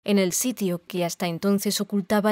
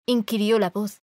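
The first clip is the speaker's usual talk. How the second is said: usual speaking voice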